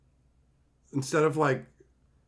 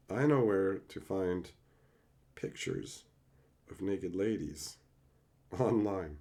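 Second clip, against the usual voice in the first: hushed reread; 9 semitones lower, 9 dB quieter than usual. Shown as a demonstration. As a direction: drop to a near murmur, pausing between phrases. Rereads text slowly, hushed.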